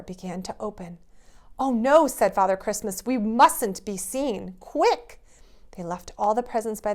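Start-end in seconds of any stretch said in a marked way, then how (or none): 1.6-2.1 alarmed. Acted. About to be caught. 3.0-5.2 urgent. Acted. Urgent escape.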